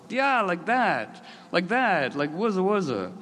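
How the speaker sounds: high, slow, drawn-out cheer. Retold or imitated. Cheering the cat guarding the rat, high and drawn out. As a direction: cheer them on like proud fans.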